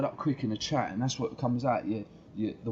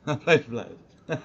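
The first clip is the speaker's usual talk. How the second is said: laughing; 3 semitones higher, 3 dB louder, much slower than usual. Retold or imitated. Laughing repeat of quote.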